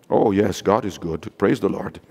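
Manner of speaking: very low, subdued, unenthusiastic churchgoer; mock lifeless praise. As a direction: imitate a listless amen from the pew. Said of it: "he can't say anything".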